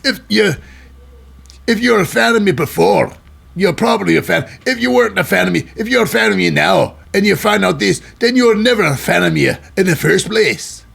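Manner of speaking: throat-straining mock Scottish speechifying; Drew McIntyre. Host acting out the wrestler addressing his fans, mock Scottish accent. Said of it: "I gotta stop doing that accent".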